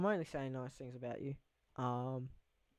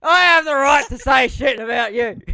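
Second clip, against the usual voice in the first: much higher, much louder shout broken by a laugh; 9 semitones higher, 17 dB louder than usual. Real, not acted. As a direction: crack up mid-sentence while yelling. Laughing brag at his friend.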